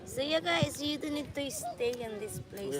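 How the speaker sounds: vlog host voice. Real, not acted. Addressing the vlog audience.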